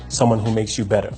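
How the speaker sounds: usual speaking voice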